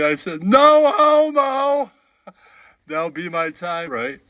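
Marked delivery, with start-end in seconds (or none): 0.4-1.9 high, slow, drawn-out put-on voice; mock squeaky voice. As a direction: squeal the punchline in a silly voice. Punchline in put-on voice.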